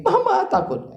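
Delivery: very high wail, imitating a child; crying child. Child crying again for mother, in a high wail. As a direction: cry out like a scared toddler. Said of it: "dia nangis lagi".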